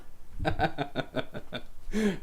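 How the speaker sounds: soft, wheezy trailing laughter; helpless laugh. Real, not acted. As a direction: laugh on quietly, running out of voice. Still laughing at the sight.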